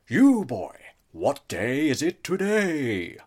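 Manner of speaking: put-on loud, slow old miser's bellow; Scrooge calling out. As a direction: call down to the boy like Scrooge. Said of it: "I refused to break character".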